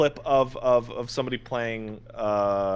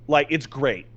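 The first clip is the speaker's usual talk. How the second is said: normal talking voice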